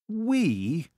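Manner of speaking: high, indignant snap; snapped retort. A narrator's reading. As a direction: snap back in offended disbelief. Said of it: "his son snapped in return".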